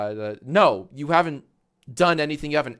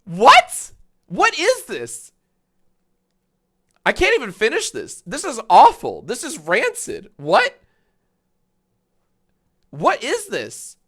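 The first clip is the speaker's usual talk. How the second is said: much higher and louder, appalled disbelief; incredulous outrage; 9 dB louder than usual. Real, not acted. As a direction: squeal it as if you can't believe your eyes. Outraged at a bad reaction video.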